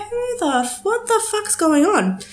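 high-pitched, retold bewildered outburst; alarmed disbelief. Retelling her reaction to mum's cry, high alarmed disbelief.

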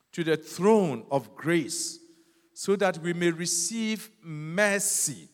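slow, drawn-out verse reading; scripture read slowly. Acted. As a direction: stretch every phrase of the verse. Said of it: "ɔse".

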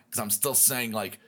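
normal talking voice

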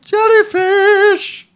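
long high drawn-out call of jellyfish. Acted. Calling to Nigel the jellyfish, long and high. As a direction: call it out slow and high across the room.

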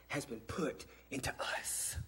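a secret passed to the congregation; hushed whisper. Acted. Preacher to his congregation, whispering the point. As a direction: breathe it out in a whisper.

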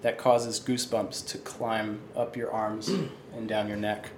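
story narration. Acted. Game master scene setting.

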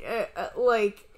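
ordinary talk

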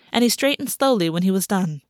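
ordinary delivery, nothing marked

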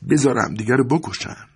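contemptuous, scornful dismissal; with disgust. A narrator's reading. Maria, disgusted, tells her husband to let them kill each other, with scorn. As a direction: spit it out with open contempt. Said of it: "ماریا با تنفر گفت".

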